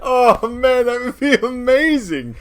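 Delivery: high, loud gush of amusement; exclaim, tickled by the idea; real delighted excitement. Real, not acted. Player loving the funny idea, loud high delight.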